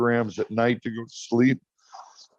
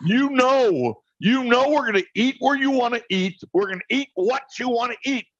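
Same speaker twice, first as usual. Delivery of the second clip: higher, mock-exasperated rant; exasperated outburst; 11 semitones higher than usual. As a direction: blow up at her, half joking. Retold outburst at wife over dinner.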